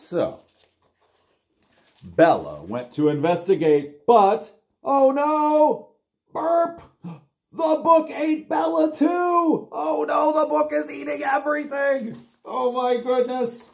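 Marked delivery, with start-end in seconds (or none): 4.1-13.7 high, loud storybook shock; dramatic alarm. Acted. Storyteller shocked that the book ate the dog. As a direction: gasp it out high for the kids.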